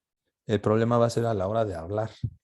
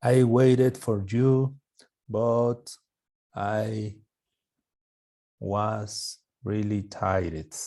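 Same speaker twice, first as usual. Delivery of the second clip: very slow, carefully spaced example sentence; slow model sentence; much slower than usual. Shown as a demonstration. Teacher slowly modelling an example sentence for the class. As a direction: spell it out slowly for learners. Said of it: "la tercera pronunciación".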